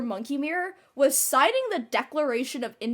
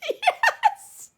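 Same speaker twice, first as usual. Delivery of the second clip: laughing; 4 dB louder than usual. Real, not acted. Laughing agreement.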